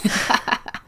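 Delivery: short laugh at own story. Real, not acted. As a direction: burst briefly into laughter.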